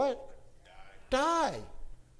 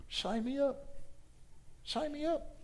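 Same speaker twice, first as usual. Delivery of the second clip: much higher, softer, eager call; eager volunteer; 11 semitones higher, 6 dB quieter than usual. Acted. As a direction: say it like a keen recruit stepping forward. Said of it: "And Paul said".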